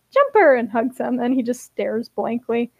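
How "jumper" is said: high, loud delighted call; excited gasp. A narrator's reading. Ari greets Jumper before a hug, excited cry. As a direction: cry the name out on sight. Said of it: "Ari gasps and is like".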